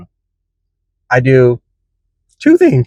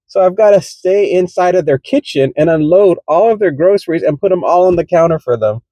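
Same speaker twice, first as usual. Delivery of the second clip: usual speaking voice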